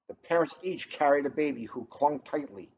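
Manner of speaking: plain reading voice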